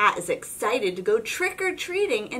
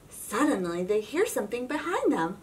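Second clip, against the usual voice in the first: plain reading voice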